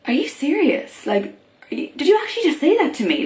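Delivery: high-pitched retold disbelief; mildly acted "did I hear that right"; incredulous. Reacting to a guy's remark, imitated disbelief.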